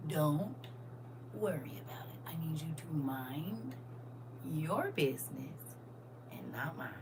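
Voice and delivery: whisper. Acted. Whispered warning to nosy people.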